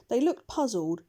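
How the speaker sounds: plain reading voice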